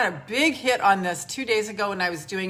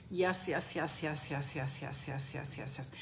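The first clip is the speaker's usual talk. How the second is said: fading soft yeses; 4 semitones lower, 8 dB quieter than usual. Acted. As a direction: lower and softer with each yes. Affirmations during grounding exercise.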